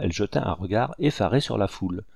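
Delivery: ordinary delivery, nothing marked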